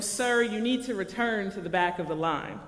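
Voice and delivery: ordinary talk, nothing marked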